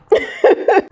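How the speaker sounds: embarrassed laugh. Real, not acted. Laugh after admitting she cried.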